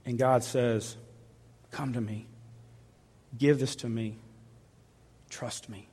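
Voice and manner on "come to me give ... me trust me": soft, low invitation; almost whisper the comfort; hushed comfort. Acted. God quietly comforting a worker who lost his job.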